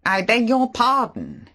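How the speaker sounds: drawn-out surprised beg your pardon; Favell. Acted. Favell startled by hidden narrator.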